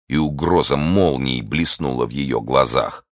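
plain reading voice

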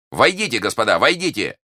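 high, loud summons; calling out. A narrator's reading. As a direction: call them in from the doorway. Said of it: "крикнул им Д'Артаньян". (громкий призывный крик)

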